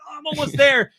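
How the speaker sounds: high, eager little cry; mock excitement. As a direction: chirp it like you've nearly made it. Self-quote, excited near goal.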